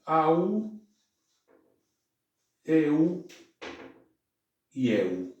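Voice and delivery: au, eu and eu said slowly, one by one; diphthong demo. Shown as a demonstration. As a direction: spell out the diphthongs au, eu, eu. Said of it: "se pronuncian". (diptongos au, eu y eu, pronunciados despacio)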